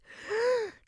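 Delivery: sharp, audible gasp in; deep breath. A narrator's reading. Narrator acts out Russ steeling himself, deep gasp. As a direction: suck in one deep breath. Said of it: "took a deep breath".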